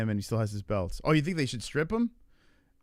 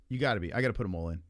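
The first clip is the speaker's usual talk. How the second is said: ordinary talk, nothing marked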